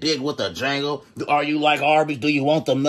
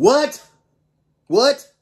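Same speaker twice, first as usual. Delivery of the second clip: loud, high, rising yelp of disbelief; incredulous outburst; 10 semitones higher, 8 dB louder than usual. Real, not acted. Reactor interrupts the clip in disbelief, incredulous outburst. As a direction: blurt it out, pitch shooting up.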